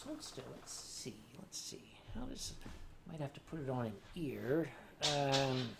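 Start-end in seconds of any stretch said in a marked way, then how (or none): 0.0-4.7 quiet, breathy whisper while fitting the hat; hushed fussing. Real, not acted. Whispering to the plush fox while fitting a hat. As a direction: whisper to keep the fox still.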